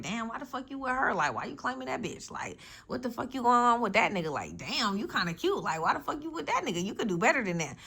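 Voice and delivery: hammed-up gossip scolding a bad choice; mock nosy people.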